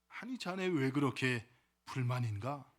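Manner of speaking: low, measured voice of an elder; old man. Acted. Old man to the complaining youth, lightly acted elder voice. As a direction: play an old man gently questioning. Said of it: "한 노인의 음성이".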